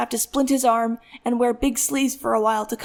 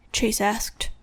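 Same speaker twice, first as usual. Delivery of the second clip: usual speaking voice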